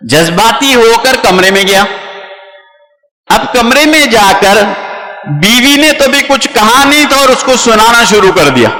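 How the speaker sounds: ordinary talk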